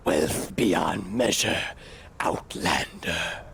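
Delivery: low, slow, airy NPC drawl; deep slow guard greeting; Morrowind NPC voice. Retold or imitated. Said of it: "they go".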